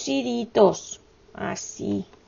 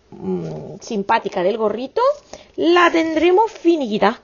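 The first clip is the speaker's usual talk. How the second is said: ordinary talk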